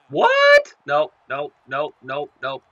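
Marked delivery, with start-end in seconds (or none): 0.1-0.7 a very high, loud squawk of disbelief; shocked yelp. Real, not acted. Gamer shrieking in disbelief at the play, shrill what. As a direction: screech it in total shock.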